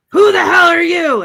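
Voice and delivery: high, loud shouted challenge in imitation; mock shriek. Zombie lady challenges Ash in the store, mock shriek. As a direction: shriek it like an affronted movie monster.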